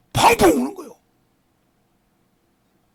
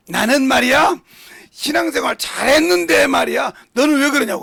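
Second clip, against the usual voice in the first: louder, slightly higher, indignant mock of a self-righteous son; mock indignant quote; 5 semitones higher, 5 dB louder than usual. Pastor mimicking sons who blame their father, loud and mocking. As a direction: throw the excuse back loudly, mocking it.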